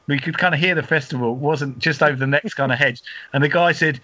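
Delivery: normal talking voice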